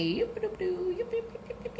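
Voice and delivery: little high-pitched yips; playful yipping. Real, not acted. Drawing blue flowers for viewers, playful high yipping. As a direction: yip along in a tiny high voice.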